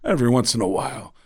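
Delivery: halting stop-and-go delivery with odd long pauses; Christopher Walken. Acted. Comedian demonstrating an actor's weird voice, stop-and-go impression. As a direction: stop dead mid-thought, then carry on. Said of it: "really weird stop and go kind of voice".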